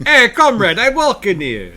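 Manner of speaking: put-on loud Boston accent, high pitched; hammed-up Boston street guy. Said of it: "that level of Boston".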